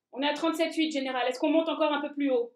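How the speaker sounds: ordinary delivery, nothing marked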